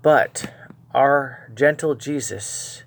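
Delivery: normal talking voice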